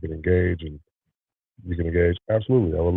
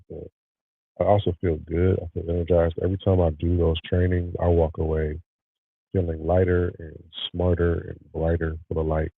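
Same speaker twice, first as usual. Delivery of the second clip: ordinary delivery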